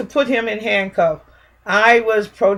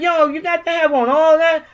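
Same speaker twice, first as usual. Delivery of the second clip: higher-pitched, disbelieving question; raised-pitch mock astonishment; retold incredulous challenge; 6 semitones higher than usual. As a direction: re-enact challenging an officer in disbelief. Retelling her challenge to officer.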